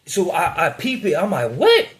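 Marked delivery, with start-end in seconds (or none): 1.5-1.9 very high, louder startled "What?"; a sudden incredulous yelp; retold disbelief. Realizes he's being robbed.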